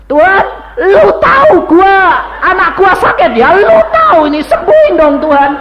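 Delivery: loud, high, blunt shouted prayer; imitated impolite prayer. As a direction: yell at God like a brash new convert. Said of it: "gak ngerti sopan santun".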